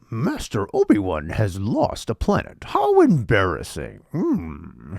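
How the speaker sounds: swooping, drawn-out creature voice; lost-planet line in a wise little alien's voice, ending on a hum; Yoda. Acted. Host quotes a Star Wars scene, playful Yoda impression. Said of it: "Oh, is that Yoda?".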